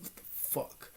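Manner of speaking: frightened whisper. Retold or imitated. Spooked by a shadow in the covers, whispered. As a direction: breathe it out scared.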